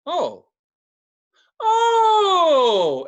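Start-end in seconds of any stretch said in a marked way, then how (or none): 1.6-3.1 huge high drawn-out "Oh!"; loudest in the bar. Retold or imitated. Drunk guy realizing who she is, loudest in the bar. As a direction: bellow it long and high, falling away. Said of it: "as loud as, I'm the loudest".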